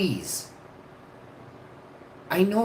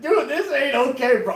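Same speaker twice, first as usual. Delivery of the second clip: laughing quote; 10 semitones higher, 4 dB louder than usual. Retold or imitated. Retelling finding smoke-filled house.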